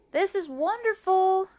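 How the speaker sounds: high, loud, gleeful exclamation; delighted. Acted. May May performing for the dogs, delighted.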